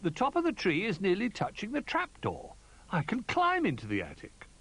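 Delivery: high, eager little bear voice; excited cry. A narrator's reading. Little Bear spotting a way up, excited. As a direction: pitch it up with eager discovery. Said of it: "cried Little Bear".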